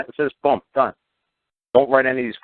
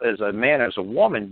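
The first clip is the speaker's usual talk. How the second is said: ordinary talk, nothing marked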